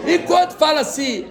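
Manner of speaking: bursting into loud laughter mid-sentence; laughing loudly. Real, not acted. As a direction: laugh out the words as the joke lands. Laughs loudly at own joke.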